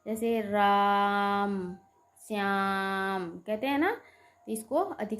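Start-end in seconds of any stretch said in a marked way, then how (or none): 0.1-3.4 राम and श्याम with the vowel stretched long and slow; drawn-out pluta vowels. Shown as a demonstration. Teacher stretching राम for students, slow drawn vowels. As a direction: hold the आ in राम for three beats. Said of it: "इसको बोलने में तीन मात्रा का समय लगता है".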